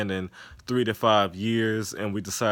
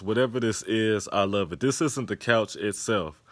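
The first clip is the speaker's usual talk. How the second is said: ordinary delivery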